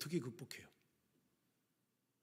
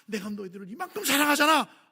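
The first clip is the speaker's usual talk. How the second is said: proclaiming; 9 semitones higher, 12 dB louder than usual. Acted. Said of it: "하나님께서 선포하시는 거예요".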